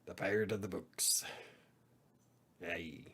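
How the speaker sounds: hushed pirate growl; whispered pirate voice. Pretend. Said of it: "I like my pirate voice".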